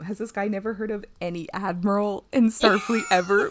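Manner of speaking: loud, exasperated outburst; indignant rant. Retold or imitated. Acted written rant about admirals.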